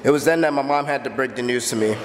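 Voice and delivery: ordinary delivery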